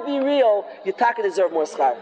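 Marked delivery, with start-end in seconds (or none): none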